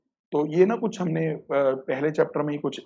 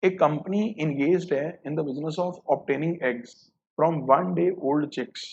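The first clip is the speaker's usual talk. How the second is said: slow read-out; much slower than usual. Shown as a demonstration. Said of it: "question number four".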